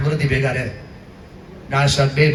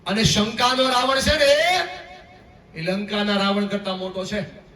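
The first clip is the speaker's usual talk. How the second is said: preaching shout; 8 semitones higher, slower than usual. Real, not acted.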